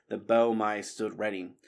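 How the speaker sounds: usual speaking voice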